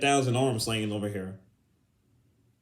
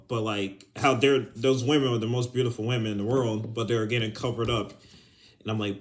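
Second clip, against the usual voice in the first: usual speaking voice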